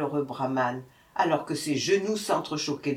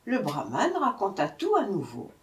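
plain reading voice

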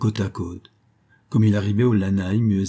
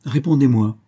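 louder, insistent order; commanding; 4 semitones higher, 5 dB louder than usual. Acted. Ford insists the manager answer him, louder firm order. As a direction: demand the answer, no room for refusal. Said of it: "ordonna Ford".

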